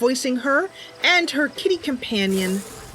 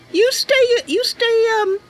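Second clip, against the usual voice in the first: put-on much higher, eager young Bosmer girl; Mercy's voice; 13 semitones higher, 3 dB louder than usual. Mercy telling her cat Sasha about the stranger, high eager voice. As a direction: lift way up into a sweet, earnest young knight-hopeful.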